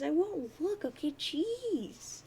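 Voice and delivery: put-on high-pitched, whiny teenage exasperation; exasperated protest. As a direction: protest with a squealed, drawn-out finish. Jade protests Dave's warning.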